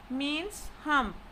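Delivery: ordinary talk, nothing marked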